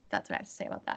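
dropped to a breathy whisper; whispered closing remark; hushed aside. Real, not acted. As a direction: mutter it as a wry sign-off. Wry closing aside on reviews.

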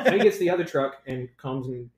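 usual speaking voice

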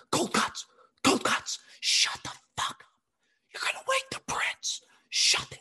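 frantic hissed whisper; desperate stage whisper; urgent whisper. Retold or imitated. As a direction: hush him without waking the prince. Hushing coworker near sleeping prince.

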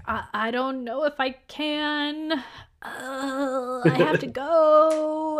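high, drawn-out mock distress; mock anguish. Willoughby tells Mama he must leave, mock anguish. Retold upset suitor makes his escape.

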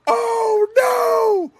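high, wailing mock dismay; shocked disbelief. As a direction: cry out as if you can't believe he did it. Reliving disbelief at the insult.

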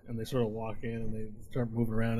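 ordinary delivery

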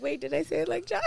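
mock self-doubt. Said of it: "I'm like".

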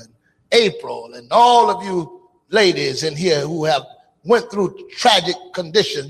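ordinary talk

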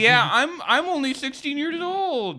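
put-on high, loud baby voice; joining the mockery of his own excuse; mock childish whine. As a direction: whimper like a teenager.

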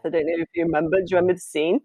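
normal talking voice

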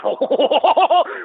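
high shrieking laugh and shout; shrieking laughter. Acted. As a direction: burst out in a screaming laugh. S0 explodes at question.

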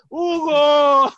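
loud, high cry of the name; shout. Retold or imitated. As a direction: yell across the training pitch. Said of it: "y gritaba". (gritando el nombre en el entrenamiento)

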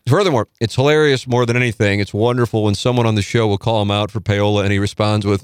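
reading aloud. Acted. Reading listener email aloud.

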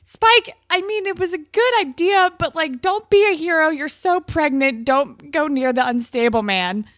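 high, loud alarmed plea to a pregnant woman; urging her not to be a hero; pleading with character. Pretend. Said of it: "I'm like".

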